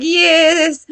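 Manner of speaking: high, eager-to-please mock yes; mock compliant wife. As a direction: imitate a submissive wife agreeing. Acts the wife's compliant answer.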